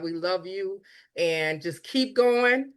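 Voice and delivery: usual speaking voice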